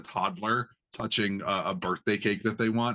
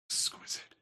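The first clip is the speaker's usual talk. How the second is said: breathy whisper; 9 dB quieter than usual. Acted. GM voices undead drinker.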